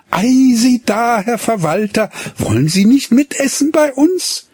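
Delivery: put-on lively girl. Lina invites the steward to supper, high and lively. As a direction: play a coquettish young daughter. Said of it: "Lina invites the steward".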